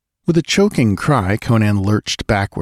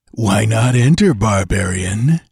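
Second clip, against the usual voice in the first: mocking. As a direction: taunt him with a mock welcome. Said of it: "he called mockingly".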